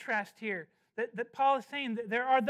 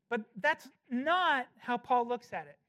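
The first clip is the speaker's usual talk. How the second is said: usual speaking voice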